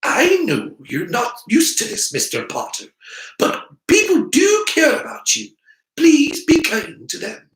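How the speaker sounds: high, loud earnest professor; stern witch. Acted. Professor pressing the boy to accept their care, earnest and loud. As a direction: urge him firmly to be kind. McGonagall pleading with Harry.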